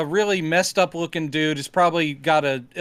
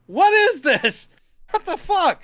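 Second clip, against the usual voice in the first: mock bafflement; 12 semitones higher, 4 dB louder than usual. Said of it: "Tom Baker's, like, going".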